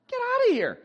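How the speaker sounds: scoffing disbelief; incredulous dismissal. Real, not acted. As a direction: wave it off in disbelief.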